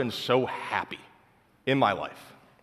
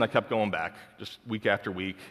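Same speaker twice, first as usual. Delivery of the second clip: ordinary delivery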